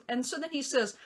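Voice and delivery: ordinary talk, nothing marked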